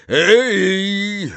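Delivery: high, loud drawn-out call; big resounding "hey"; booming shout. A narrator's reading. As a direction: holler it across the yard. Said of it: "rozległ się donośny głos".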